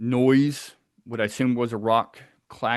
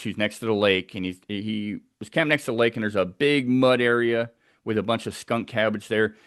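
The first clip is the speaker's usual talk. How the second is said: normal talking voice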